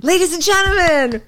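big game-show host intro; announcer hype intro. Pretend. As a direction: hype up the crowd.

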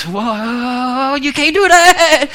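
high, drawn-out mock wail; protesting boss. Boss objecting to his waiter's joke, mock-scandalised protest. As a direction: stretch it into a rising, slow protest.

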